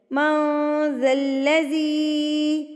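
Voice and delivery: الذی with a long held "zee"; slow recitation, stretch the end of الذی; drawn-out vowel. Shown as a demonstration. Teaching yaa madda, long drawn-out "zee" in الذی. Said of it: "زی کو ہم نے کھینچ کے پڑھنا ہے".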